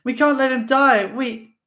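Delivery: very high, loud pleading; desperate plea. Acted. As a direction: beg him frantically.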